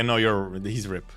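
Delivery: ordinary delivery, nothing marked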